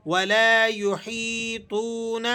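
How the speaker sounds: یحیطون with the waw long and the nun short; slow ولا یحیطون. Shown as a demonstration. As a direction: stretch the toon, clip the nun. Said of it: "اسے بھی آپ کھینچ لیں گے".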